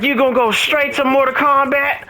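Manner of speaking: high, accusing imitation of the girlfriend; mock girlfriend voice. Boyfriend mimics girlfriend scolding him for gaming, high mock voice.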